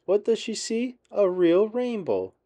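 high, building wonder; excited reveal. A narrator's reading. Narrator reveals rainbow to young listeners, excited and high.

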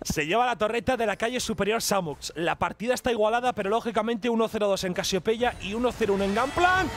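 measured call building to a stretched shout-out; esports match commentary. Acted. Caster updates score and greets birthday player, announcer's play-by-play. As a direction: report the score, then draw out the greeting.

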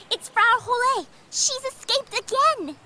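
alarmed. Acted. The cow has escaped again.

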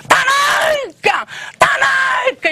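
a long high shrieking call; high drawn-out cry. Retold or imitated. Woman cries out during slaps.

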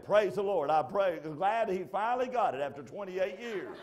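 loud, high shouted praise; exultant wife. Acted. Preacher imagining his wife rejoicing at home, exultant shout. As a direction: cry it out high and loud in mock relief.

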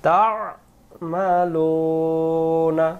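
তা'মালুনা with the ain from the throat, slow and chanted; slow recited তা'মালুনা with the throat letter ain; correct throat sound. Shown as a demonstration. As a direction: bring তা'মালুনা up from the throat, stretched. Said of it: "হুজুর আবার বলেন".

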